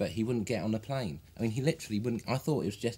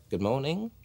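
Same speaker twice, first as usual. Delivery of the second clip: slightly higher, put-on phone greeting; a bit of something; 4 semitones higher than usual. Shown as a demonstration. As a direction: add a little performed polish. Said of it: "just give it a, a little bit of something".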